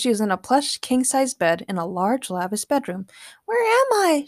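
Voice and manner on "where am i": high confused voice; Morgana confused. A narrator's reading. Morgana waking in a strange bed, confused. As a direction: ask it dazed. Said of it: "she asked".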